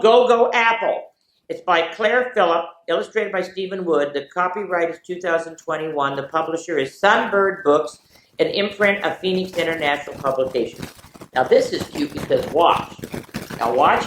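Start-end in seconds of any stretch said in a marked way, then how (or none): none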